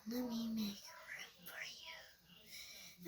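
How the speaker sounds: slow eerie ghostly whisper; creepy whisper. Retold or imitated. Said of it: "That's what she says".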